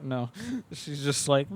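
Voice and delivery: ordinary talk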